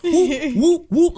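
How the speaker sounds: high playful "whoop" noises; imitated whooshing. Friend mimics a screen flying with messages, playful whoops.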